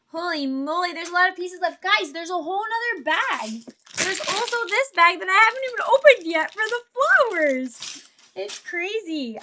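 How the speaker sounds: real disbelief at how much is left; surprised. Real, not acted.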